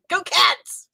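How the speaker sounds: put-on high Boston accent cheer. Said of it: "saying cards in a Boston accent".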